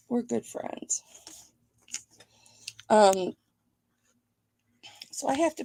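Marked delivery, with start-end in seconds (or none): 0.1-1.0 real whisper, unvoiced and hushed. Real, not acted. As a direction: breathe it so the mail lady won't hear. Aside while mail lady is near.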